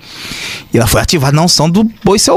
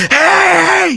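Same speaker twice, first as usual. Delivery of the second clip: high, drawn-out "hey" cry; long, high-pitched holler; imitated pastor's shout. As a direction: mimic a pastor yelling out. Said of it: "um pastor gritou".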